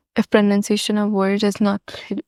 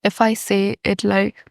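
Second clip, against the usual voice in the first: ordinary talk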